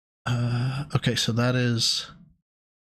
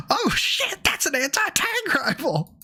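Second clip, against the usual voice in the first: very high, squealing "Oh, shit" surprise; excited. Real, not acted. Repeats line much higher.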